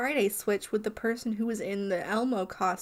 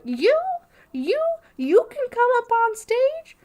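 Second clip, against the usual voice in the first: high squeaky puppet voice, louder; Elmo voice; 13 semitones higher, 8 dB louder than usual. Retold or imitated. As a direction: pick kids out like Elmo, bouncing. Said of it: "I don't know what this voice is".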